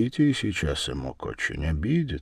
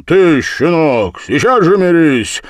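high, loud scolding; booming angry rebuke; thundering command; 11 semitones higher, 11 dB louder than usual. A narrator's reading. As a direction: order the young hothead sharply, at full voice. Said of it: "загремел он".

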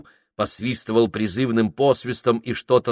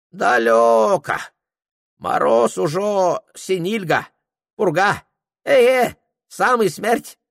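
put-on high, broken-Russian old Tungus voice; a much higher Tungus character voice; Tungus guide; 11 semitones higher, 3 dB louder, slower than usual. Tungus greeting Prokhor in a high character voice.

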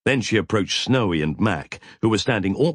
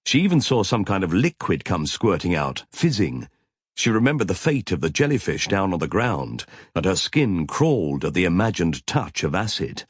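ordinary delivery

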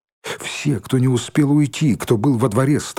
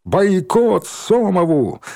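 high, louder, drawn-out accusation; ringing indignant cry, pitched up; relentless rabble-rousing; 7 semitones higher, 3 dB louder, slower than usual. A narrator's reading. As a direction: rouse the crowd to a chant. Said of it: "не унимался Валька".

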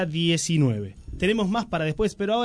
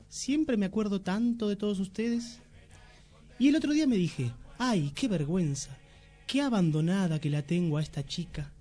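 pompous aunt; 6 semitones higher, 5 dB quieter than usual. Acted. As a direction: read it like a vain old aunt. Reads aunt's letter in sketch.

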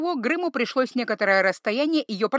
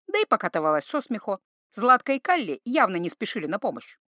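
ordinary delivery

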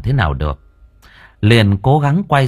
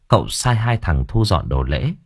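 plain reading voice

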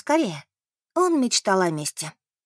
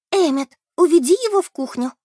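urgent hiss, higher and louder; hissed order; 7 semitones higher, 6 dB louder than usual. A narrator's reading. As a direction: hiss a sharp command at him. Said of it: "прошипела Бет". (сердито шипит, приказывая)

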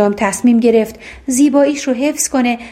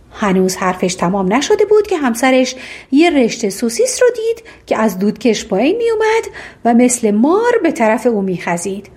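ordinary delivery, nothing marked

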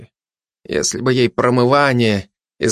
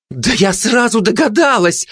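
alarmed exclamation; 10 semitones higher than usual. A narrator's reading. Gossip recoils from poisoned glutton.

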